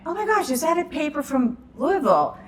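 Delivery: excited. Retold or imitated. Spotting the Kentucky paper.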